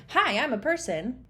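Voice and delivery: mock-perky, out-of-nowhere greeting; naive auditioner's opening. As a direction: blurt it out like an unprepared auditioner. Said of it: "So you don't just start".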